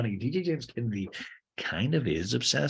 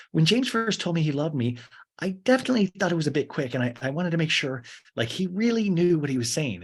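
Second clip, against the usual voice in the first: higher, mock girlfriend voice; high-pitched imitation of a careful confession; Allie; 8 semitones higher, 4 dB louder than usual. Girlfriend recalling an early love declaration, mock earnest and high. Plays Allie in a talking head.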